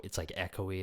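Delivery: ordinary talk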